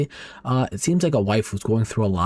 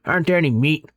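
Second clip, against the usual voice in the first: put-on high, mouth-full old man complaint; chewing old man; 7 semitones higher, 3 dB louder than usual. A narrator's reading. Grandfather chews and complains about lunch, mouth full. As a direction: complain through a mouthful of food. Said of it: "starts muttering and chews really disgustingly".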